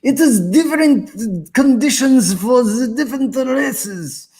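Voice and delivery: high, slow put-on foreign accent; playful put-on foreign accent quoting a viewer; mock accent for Ludwig. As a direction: read his line in a put-on foreign accent, slowly. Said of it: "that's my accent for you".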